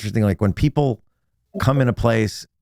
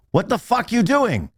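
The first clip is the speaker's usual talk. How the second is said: high-pitched outraged boss impression; retold angry boss; 11 semitones higher, slower than usual. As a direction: imitate an indignant boss, voice shooting up. Boss catches new hire eating.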